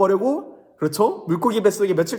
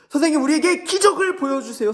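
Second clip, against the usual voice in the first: high, loud begging voice; pleading Pharisee; 10 semitones higher, 5 dB louder, faster than usual. Acted. Pharisees begging Jesus for a miracle, high pleading. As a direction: beg the teacher for a miracle. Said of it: "라고 얘기한 거예요".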